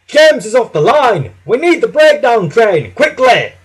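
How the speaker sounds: high, loud alarm call; urgent shout. A narrator's reading. Man running for the breakdown train, urgent shouting. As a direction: raise the alarm at full voice. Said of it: "he shouted".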